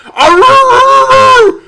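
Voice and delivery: loud, high happy bark; excited dog bark. A narrator's reading.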